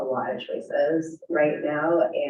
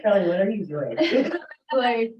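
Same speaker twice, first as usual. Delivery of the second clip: quoting them through a laugh, mock puzzled; laughing disbelief; 8 semitones higher than usual. Retelling supervisors teasing her taped voice, laughing. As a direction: giggle through the mock question.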